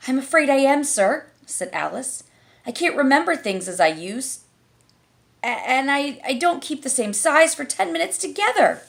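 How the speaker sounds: put-on a flustered little girl; Alice's voice. A narrator's reading. Alice answers caterpillar.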